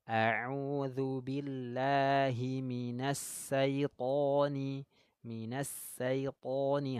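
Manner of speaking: a'udzu billahi minas syaitoni drawn out slowly; slow recitation. Shown as a demonstration. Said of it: "Huruf shin ya sifatnya atafashi".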